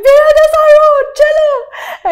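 very high, loud exaggerated wail; mock crying. Telling rickshaw driver her street in tears, mock crying. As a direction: bawl the directions, hammed up. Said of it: "And I'd be crying".

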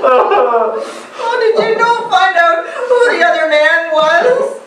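high, sob-choked question; tearful questioning. Acted. Woman asking who the other man was, tearfully. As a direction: ask through hammed-up tears. Weeping over friend's unfaithful wife.

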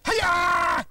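sharp high martial arts yell; karate shout. Acted. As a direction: snap it out like a karate chop. Magician's dramatic karate shout.

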